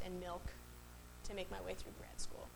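softly, humbly admitting it; quiet, low-key quote of a friend; subdued retold confession. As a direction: drop the voice right down. Friend retells his struggle.

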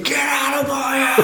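high, drawn-out imitated scream; strained high scream, held back in volume. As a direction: imitate someone screaming through a night terror. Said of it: "just screaming".

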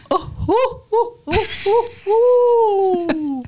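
very high, fluttery laughing oh-oh-oh; a hammed-up old English lady tittering; aristocratic English laugh. Host showing her English-accent laugh to cohost. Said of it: "You sound like Santa".

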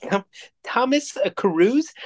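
"Thomas, uh, Cruise" high and halting, as a question; mock unfamiliarity. Co-host prompts friend on a supposedly unknown actor, mock-puzzled. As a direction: hesitate over "Cruise" and lift it like a strange name. Repeats the pretend obscure name.

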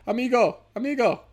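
high-pitched caricature calling out; hammed-up high cry, swooping down; mock Mexican migrants. Comedian voices migrants hailing the president, mock high calls. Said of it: "a ton of Mexicans like".

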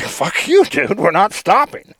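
high sneering retort; mock wife snapping. Wife refusing her drunk husband, high mocking snap. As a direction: throw it back at him, defiant.

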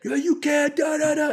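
high squeaky mock heckler voice. Comedian mocking a heckler in a shrill high voice. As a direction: mimic a ranting audience member, way up high.

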